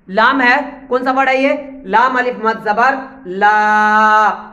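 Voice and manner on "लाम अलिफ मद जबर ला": slow spelling with the ला held long; drawn-out madd vowel; stretched ला. Shown as a demonstration. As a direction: hold ला long to show the madd. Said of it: "इसको खींचा क्यों है?".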